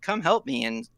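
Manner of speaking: usual speaking voice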